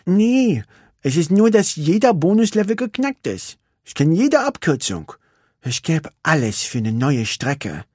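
put-on high, bored young racer; Vanellope's voice. Vanellope tells Ralf she is bored, wistful.

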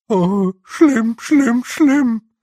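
high, loud, fretful little voice; squeaky wail. Acted. A small blue man wails in fear at the snow. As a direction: wail it like a panicking small creature. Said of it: "sagte einer von ihnen".

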